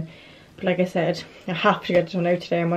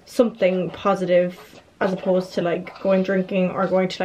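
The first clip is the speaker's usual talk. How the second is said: ordinary delivery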